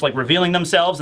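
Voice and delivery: ordinary talk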